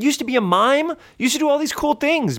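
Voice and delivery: high, pressing scolding; exasperated pep talk. Acted. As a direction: urge him hard to move on. Heated lecture to friend.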